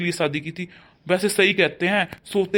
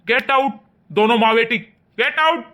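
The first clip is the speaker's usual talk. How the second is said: loud, high get out; shouted order; 5 semitones higher, 6 dB louder than usual. Acted. Father ordering stepmother and daughter out, shouting. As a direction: throw them out at the top of your voice.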